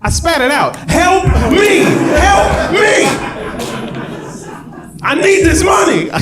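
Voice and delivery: high, loud, frantic pleading; panicked blood donor. Retold or imitated. Man filling the form begs for the blood money, frantic. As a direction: beg the nurse like a desperate man. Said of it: "there's somebody who gets to that question and they're like".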